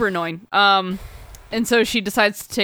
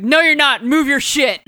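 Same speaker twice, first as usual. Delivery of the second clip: sharp retold demand, higher and louder; snapping at him; 6 semitones higher, 5 dB louder than usual. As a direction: tell the guy in your seat off, hard. Retold telling off a seat-stealer.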